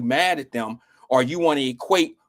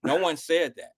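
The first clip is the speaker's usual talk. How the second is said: ordinary talk, nothing marked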